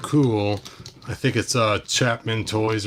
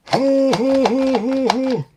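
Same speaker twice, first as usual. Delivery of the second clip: very high, loud, slow cackling villain; villain laugh; 6 dB louder, slower than usual. Pretend. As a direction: gloat like a cartoon baddie. Toy battle acted out.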